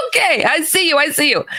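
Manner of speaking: high-pitched, retold nervous surrender; acted-out jittery alarm; alarmed placating. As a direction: back away while giving in. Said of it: "I'm pressing up against the door".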